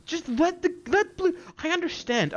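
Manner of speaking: high, heated tirade; angry rant. Real, not acted. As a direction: lash out in outraged indignation. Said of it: "I did not expect to get this angry".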